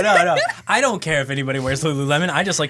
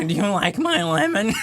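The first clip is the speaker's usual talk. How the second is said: higher put-on character voice; silly, higher comic voice; Lulu voice; 4 semitones higher than usual. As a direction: offer it as an odd little character. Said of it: "I'll do that voice sometimes".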